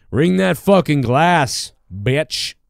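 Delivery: slow and loud, leaning on fucking. Shown as a demonstration. Host hamming up his own catchphrase, slow and loud. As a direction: drag it out and punch fucking. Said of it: "repeated louder".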